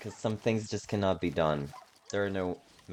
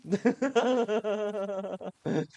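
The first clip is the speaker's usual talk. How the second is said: long giggling fit, higher; long high laugh; 13 semitones higher than usual. Real, not acted. Streamer laughing at her own hunting noises.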